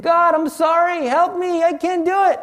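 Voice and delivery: high, tearful begging, reenacted; retold crying plea. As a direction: plead as if crying out for help. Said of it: "I started crying".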